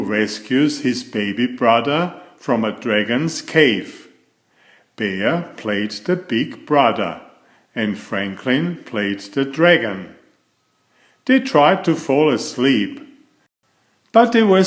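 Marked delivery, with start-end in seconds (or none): none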